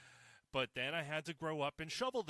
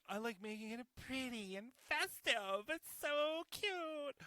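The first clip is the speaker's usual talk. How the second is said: gushing; 10 semitones higher than usual. Acted. Vlogger cooing over pretty Christmas decorations, squealing high. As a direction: coo it like the cutest thing ever.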